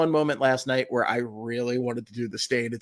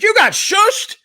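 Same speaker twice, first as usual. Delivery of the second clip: loud, very high shocked question; hammed-up outraged disbelief, pitched way up; incredulous; 7 dB louder, slower than usual. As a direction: imitate an owner who can't believe it. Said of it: "He couldn't believe it".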